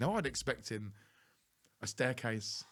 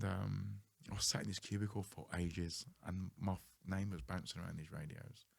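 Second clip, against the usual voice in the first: normal talking voice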